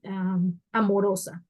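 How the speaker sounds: usual speaking voice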